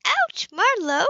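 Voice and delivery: high, loud, slow ouch; crying out in pain at the supervisor; pained yelp. A narrator's reading. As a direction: yelp at the sting. Yelps while being doctored.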